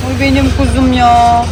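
yüksek sesle, şefkatle sevme; sevgiyle. Real, not acted. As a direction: kuzusunu sever gibi. Sevgiyle çocuğu seviyor. (çocuğunu yüksek sesle, şefkatle seven anne)

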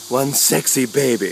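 normal talking voice

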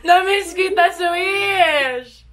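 high, laughing call to a friend; laughing affection. Real, not acted. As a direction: squeal it through real laughter. Laughing with friend after prank.

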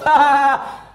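imitating dad's cruel high cackle; hammed-up gloating laugh; dad laughing at his son's fear; loud mocking laugh. Dad laughing after prank.

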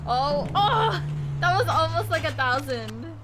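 loud, thrilled oh oh; excited cry. Real, not acted. Streamer to chat, thrilled about nearly a thousand, loud. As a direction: cheer at a near miss. Almost hits high score.

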